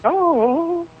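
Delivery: high ghoulish "oh"; put-on eerie falsetto moan; character voice. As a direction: pitch it way up like a spooky manager. Demonstrating the character voice.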